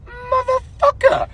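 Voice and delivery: very high, slow squeak of dread; a drawn-out panicked squeal under the breath; stretched-out high whine of doom; mock dread. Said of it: "I'm like".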